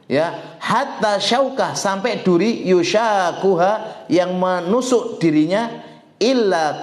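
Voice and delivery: hadith recitation. Acted. Preacher reciting hadith on thorns, high slow chant. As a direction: lift and stretch the sacred words.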